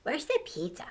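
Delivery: plain reading voice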